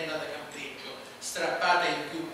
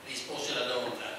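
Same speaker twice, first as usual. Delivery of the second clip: ordinary delivery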